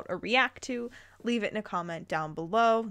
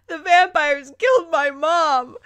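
loud, higher, mock-crying wail; hammed-up wail; 6 semitones higher, 10 dB louder than usual. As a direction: overact the heroine's grief. Reader hams up the grief.

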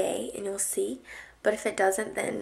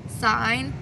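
ordinary delivery

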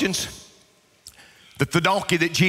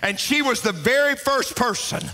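fervent preaching climax; 7 semitones higher than usual. Real, not acted. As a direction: proclaim it to the whole church, rising to the last word.

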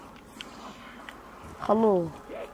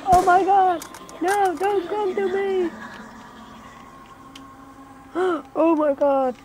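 high, loud frightened cries, backing away; panicked shout; 8 semitones higher, 4 dB louder than usual. Real, not acted. Player startled by the witch, screaming in fear. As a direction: yell in fright as the witch comes close.

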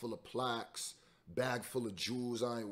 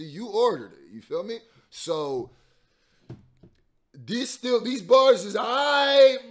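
worked-up rant; 9 semitones higher, 13 dB louder than usual. Real, not acted. Heated pizza analogy rant.

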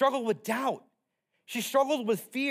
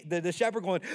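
normal talking voice

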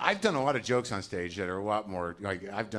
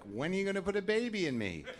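imitating wife; 10 semitones higher than usual. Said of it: "she says things like".

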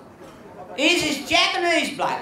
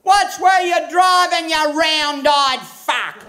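high, drawn-out yell; yelled insult; 5 semitones higher, slower than usual. Acted. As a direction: holler it slowly out the window at a passing driver. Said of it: "He yells out the window".

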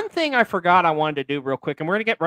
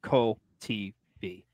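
slow, spelled-out I heart Cole T V; slow catchphrase; 6 semitones lower than usual. Shown as a demonstration. Host showing viewers the comment, slow and clear. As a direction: say it slowly for viewers to copy. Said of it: "just make the comment".

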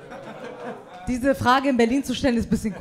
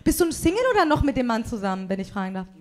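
ordinary delivery

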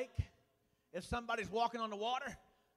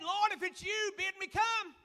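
Peter calling out; 9 semitones higher than usual. Acted. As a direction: call out to the Lord from the boat. Peter calling to Jesus on water.

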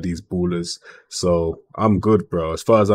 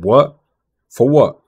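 high, louder, disbelieving snap of a question; incredulous what; 4 semitones higher, 6 dB louder, slower than usual. Real, not acted. Manager hearing Rafa wants a new contract, incredulous outburst. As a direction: react like the request is absurd.

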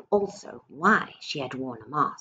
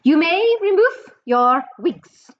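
much higher, louder, slow snarl; snarled witch order; 12 semitones higher, 8 dB louder than usual. Acted. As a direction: snarl the command at the crowd. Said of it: "snarled the Grand High Witch".

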